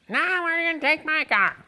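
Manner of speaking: high, whiny insistence; mock Lou digging his heels in; Lou insisting. Narrator imitates Lou refusing his car, high and whiny.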